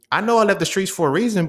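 defensive comeback. Retold or imitated. Answering peers who say he left the streets, defensive. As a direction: push back at the admonishment. His heated reply to peers.

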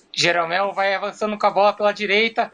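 rising play-by-play into goal cry; radio goal commentary. Acted. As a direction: narrate like a Brazilian radio man. [name] narrates Grêmio goal.

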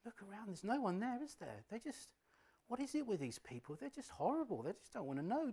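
high, soft, coaxing whisper-like voice; right in your ear. Acted. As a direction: seductive tempter close in the ear. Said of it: "He will be this really seemingly soothing voice".

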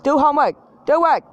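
high, loud tiger-mom command; short, high-pitched shouted orders. Acted. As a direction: order a kid around like a strict mom. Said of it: "being a tiger mom on myself".

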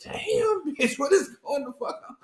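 high, amazed aside at the scene; stunned disbelief. Retold or imitated. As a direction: gasp it out, scandalised. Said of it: "And I'm like".

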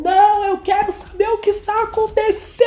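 very high, frantic demand; demanding to know. Retold or imitated. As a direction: shriek out wanting the truth. (exigindo saber, bem agudo)

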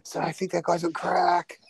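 mock onlooker. Clubgoer judging the dancer, mock gossip.

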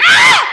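sharp, high, very loud shriek of fright; sudden scream. Retold or imitated. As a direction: let out a startled scream you didn't know you had. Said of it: "you catch yourself screaming".

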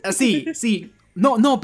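usual speaking voice

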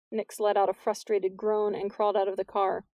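plain reading voice

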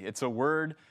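ordinary talk

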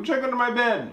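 put-on high-pitched kid voice; pleading child. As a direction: plead with mom like a kid at bedtime. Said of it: "you go".

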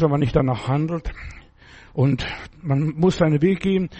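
ordinary talk

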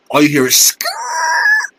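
high drawn-out skrrr like tires skidding; imitated screech of a skid; skid noise.

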